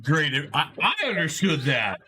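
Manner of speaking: high, slow, laughing amazement; amazed. Retold or imitated. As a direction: gush with wonder. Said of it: "I'm like".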